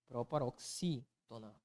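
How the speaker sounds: "Proparoxítona" slow and soft, xí set apart; syllable emphasis. Shown as a demonstration. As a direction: break "Proparoxítona" around a stressed xí. Teacher sounds out answer by syllables. ("Proparoxítona" devagar e baixo, destacando o xí)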